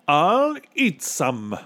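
slow, high, drawn-out announcement; pompous statement falling at the end; boastful captain. A narrator's reading. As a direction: declare it with bravado. Captain boasts he will eat.

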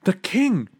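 high, startled exclamation; a gasp of sudden realization. A narrator's reading. Bartholomew suddenly remembers the king amid the hubbub, gasping. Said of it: "Bartholomew gasped".

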